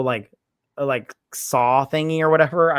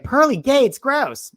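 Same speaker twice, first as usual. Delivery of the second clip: high, loud mock disgust; 12 semitones higher, 6 dB louder, slower than usual. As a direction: squeal it in disgust. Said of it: "she's like".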